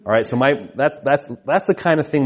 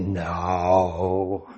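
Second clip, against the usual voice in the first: put-on little boy; 5 semitones lower, 5 dB quieter than usual. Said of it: "And he said".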